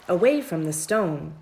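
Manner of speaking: usual speaking voice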